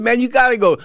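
high, loud, pressing appeal; retold frantic urging; urgent pleading. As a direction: push him hard to get moving. Urges fighter to go fight.

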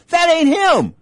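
insisting. Retold or imitated. Retold argument with corner man.

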